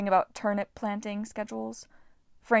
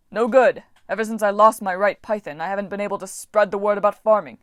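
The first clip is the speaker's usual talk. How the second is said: spinning loud lament; 8 dB louder than usual. Acted. God complains while spinning.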